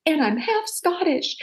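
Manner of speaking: put-on high, slow, stubborn little-girl protest; childish insistence. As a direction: insist like a stubborn kid in an argument. Arguing with grandma as a kid.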